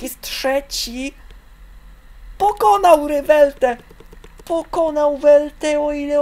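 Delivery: very high, loud thrilled voice; over-the-top delighted sports commentator; ecstatic commentary. Real, not acted. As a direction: scream with joy for the home jumper. Polish jumper wins, wild commentary.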